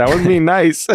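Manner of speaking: quoting the character. Retold or imitated. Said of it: "When he said".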